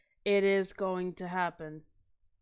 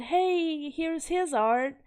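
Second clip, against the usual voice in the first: eager recommendation; 6 semitones higher, 3 dB louder, much slower than usual. Retold or imitated. Retelling a friend's eager recommendation.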